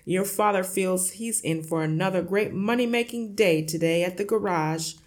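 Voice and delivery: loud, booming, boastful father; noisy, self-satisfied bluster; hearty shout. A narrator's reading. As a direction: shout it out like a cocky man of the house. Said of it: "slapped his son on the back and shouted".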